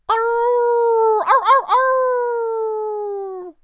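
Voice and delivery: long, high, loud coyote howl. Acted. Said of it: "what kind of sound does a coyote make".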